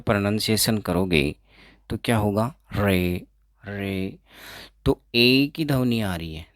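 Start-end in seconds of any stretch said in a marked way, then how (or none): 2.7-4.2 रे said with a clear r before the vowel; रे, r pronounced, falling; sounded r demo. Shown as a demonstration. Teacher showing students रे with the r pronounced. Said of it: "pronounced होगा".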